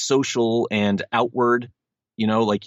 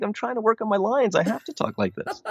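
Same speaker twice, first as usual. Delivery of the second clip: high-pitched put-on voice; actor dad's retort; 12 semitones higher than usual. Dad answers kids while practising his lines, high put-on protest. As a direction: plead your case in a raised character voice. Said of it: "I'm like".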